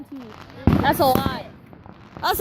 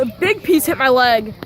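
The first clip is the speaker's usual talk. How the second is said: ordinary talk, nothing marked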